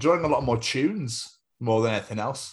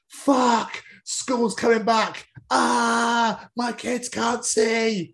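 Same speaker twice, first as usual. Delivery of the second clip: much higher mock crying parent; mock wailing; 13 semitones higher, 4 dB louder, much slower than usual. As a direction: wail it like a panicked parent. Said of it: "a lot of people are like".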